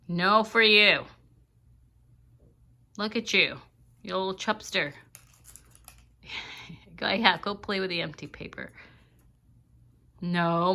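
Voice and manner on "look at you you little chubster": ordinary talk, nothing marked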